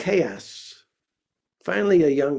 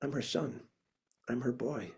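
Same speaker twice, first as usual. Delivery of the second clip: much softer, meek and hesitant; small scared voice; 3 semitones lower, 14 dB quieter than usual. Retold or imitated. Son telling the club he's her boy, meek and soft. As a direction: barely get the words out, like a frightened kid.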